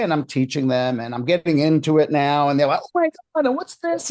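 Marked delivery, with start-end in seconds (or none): 2.8-4.1 very high squeal of wonder, imitating; mock amazement. Women react to his teaching, amazed imitation. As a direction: mimic wowed listeners in a high voice.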